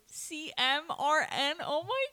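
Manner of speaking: slow, high and loud, squealing with delight; thrilled squeal. Retold or imitated. New nurse reacting to writing her title, squealing. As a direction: stretch it out in disbelief and joy. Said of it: "And I said it literally like that".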